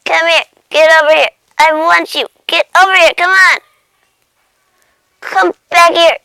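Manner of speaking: loud, effortful bursts; frantic chase. Acted. Cat trying to catch the butterfly, frantic yelling. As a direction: yell in short pouncing shouts.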